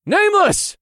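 high, loud shout of a name. Acted. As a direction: call out in alarm. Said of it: "I shouted".

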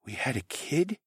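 soft strained disbelief. A narrator's reading. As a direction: choke out the question softly. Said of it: "asked in a strained voice".

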